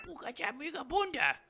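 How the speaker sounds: high squeaky goblin gibberish; goblin tongue. Acted. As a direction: speak Goblin in a high little voice. Goblin speaks Goblin.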